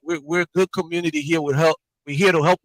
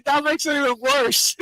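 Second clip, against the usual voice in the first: laughing; 9 semitones higher than usual. Real, not acted. Laughing at the name story.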